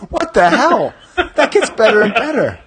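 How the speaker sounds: gleeful disbelief at the wording; amused disbelief. Real, not acted. Host reacting to the Stormtrooper blurb, laughing in disbelief. Reacting to absurd packaging text.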